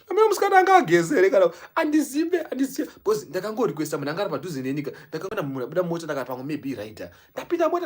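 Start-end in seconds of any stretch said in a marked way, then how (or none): 0.1-2.9 indignant outburst. Retold or imitated. Indignant retelling.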